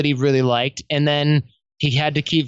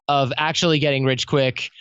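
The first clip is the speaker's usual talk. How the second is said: normal talking voice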